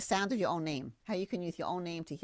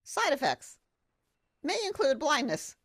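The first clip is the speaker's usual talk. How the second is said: mock warning label; 8 semitones higher, 3 dB louder, slower than usual. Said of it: "and it said".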